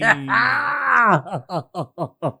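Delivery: long, high cackling laugh. Real, not acted. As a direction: let out a wailing laugh. Loud drawn-out laugh.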